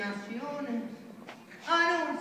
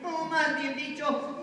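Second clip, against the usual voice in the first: normal talking voice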